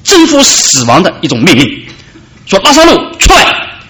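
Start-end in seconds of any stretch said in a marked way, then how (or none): 2.6-3.8 almighty command. Acted. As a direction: command the dead to rise. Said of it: "那是一种全能的命令".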